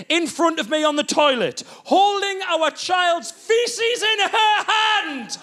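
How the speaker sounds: high, building comic outrage; appalled climax. Acted. Husband describing rock bottom of marriage, appalled. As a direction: draw out each horror, rising to the peak.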